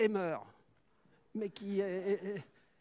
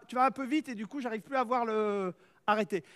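usual speaking voice

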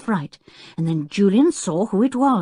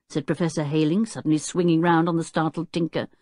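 usual speaking voice

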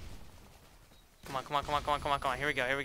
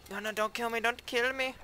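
panicked pleading; 7 semitones higher than usual. Real, not acted. Pleading while attacked.

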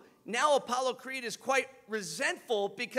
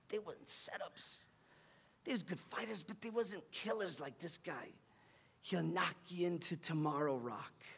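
very soft, subdued coach's admission; hushed confession; 4 semitones lower, 11 dB quieter than usual. Retold or imitated. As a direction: break it to him quietly. Coach gently confessing to boxer.

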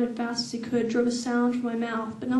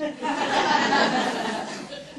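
airy laugh; 3 semitones higher than usual. Real, not acted. Reader laughs again.

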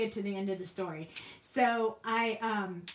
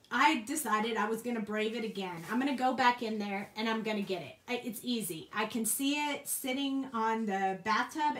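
ordinary delivery

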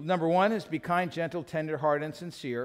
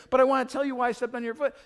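high, rushed acted pleading; insistent excuse; 8 semitones higher, 4 dB louder, faster than usual. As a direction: push the excuse through over her objection. Husband insists on his excuse.

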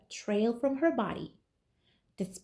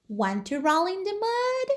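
lightly voiced piggy; 8 semitones higher than usual. Pig asks the spider.

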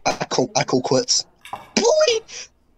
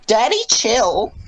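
put-on higher, slow girly voice; girl voice; 6 semitones higher, slower than usual. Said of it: "especially in your girl voice".

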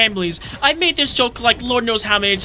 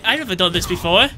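usual speaking voice

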